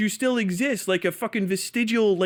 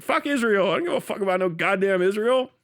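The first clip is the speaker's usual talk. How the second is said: ordinary talk, nothing marked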